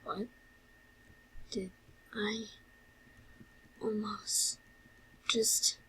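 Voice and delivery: soft words with long gaps between; halting, stunned. Acted. Character alone realising what almost happened, stunned and halting. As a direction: stop after each word as it sinks in. Character shocked at own act.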